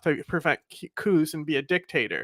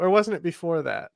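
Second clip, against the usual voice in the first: ordinary delivery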